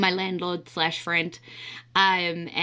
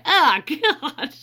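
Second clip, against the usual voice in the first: laughing dismay; 7 semitones higher, 6 dB louder than usual. Retold or imitated. Retold dismay at bad news.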